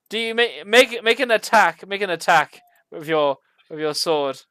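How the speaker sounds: usual speaking voice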